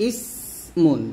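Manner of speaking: ইসমুন with long hissed s, no vowel after; unbounced sin. Shown as a demonstration. Teacher modelling sin with sukun, unbounced s. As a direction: hold the s of ইসমুন without bouncing. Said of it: "কলকলা না করে ফেলি".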